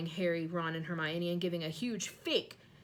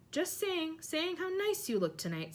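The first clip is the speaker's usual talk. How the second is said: forced brightness; 10 semitones higher than usual. A narrator's reading. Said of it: "glaring at Peeves".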